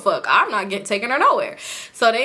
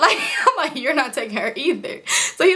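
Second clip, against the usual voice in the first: giggling through the quote; laughing; 4 semitones higher than usual. Real, not acted. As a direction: keep laughing under the words. Laughing while retelling refusal.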